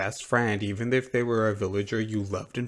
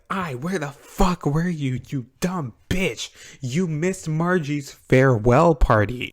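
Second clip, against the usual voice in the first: loud, higher-pitched mock tirade; slow, punched-out retold fury; hammed-up fuming; 4 semitones higher, 6 dB louder than usual. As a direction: chew her out theatrically, drawing out the insults. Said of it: "Rosie is fuming".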